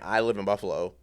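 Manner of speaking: low, soft offhand reply; nonchalant. Retold or imitated. Telling a friend where they live, nonchalant. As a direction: toss it off like it's nothing. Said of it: "I was so nonchalant with it".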